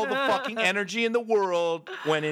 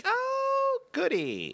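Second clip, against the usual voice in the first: gloating mom; much slower than usual. Retold or imitated. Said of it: "the way my mom would win".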